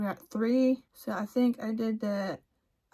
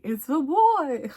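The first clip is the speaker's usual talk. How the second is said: higher, louder, drawn-out announcement; surprise reveal; 5 semitones higher, 7 dB louder, slower than usual. Retold or imitated. Reads her gender result after expecting a girl, surprised reveal. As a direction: announce it slowly as a big twist.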